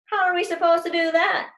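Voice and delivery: high, loud complaint; exasperated. Acted. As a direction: whine it out loud. Kids protesting teacher's plan.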